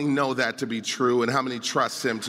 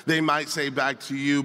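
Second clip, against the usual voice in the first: ordinary talk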